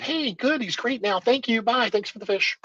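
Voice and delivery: high, chirpy mock-cheerful mom voice; breezy mom. Teacher imitates the mother leaving happily, lightly high-pitched. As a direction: toss off a quick goodbye like a mom already out the door.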